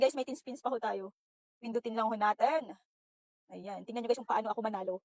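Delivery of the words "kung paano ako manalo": ordinary delivery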